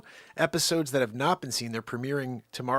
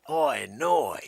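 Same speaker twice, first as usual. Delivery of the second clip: mock Australian; 2 semitones lower, 7 dB louder than usual.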